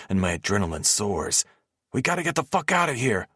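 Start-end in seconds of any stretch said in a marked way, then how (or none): 1.9-3.3 high, panicked yell; urgent high shout to flee. Acted. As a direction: yell at them to run. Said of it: "Wolf yells".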